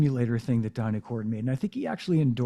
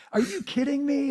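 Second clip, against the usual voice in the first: amazed disbelief; 13 semitones higher than usual. Retold or imitated. Host recalling his reaction to Jeff, astonished. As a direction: pitch jumps much higher in disbelief.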